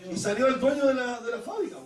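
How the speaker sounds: usual speaking voice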